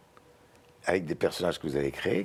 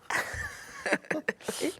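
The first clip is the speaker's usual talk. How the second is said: squeaky high-pitched giggle; high squealing laugh; 5 dB quieter than usual. Acted. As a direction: burst into a shrill cartoon laugh. Snail squashed, cartoon joke.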